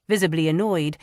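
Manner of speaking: usual speaking voice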